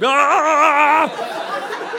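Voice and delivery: high shocked "Ah!" with a laugh; sudden high squeal of alarm; horrified yelp. Retold or imitated. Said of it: "and just like".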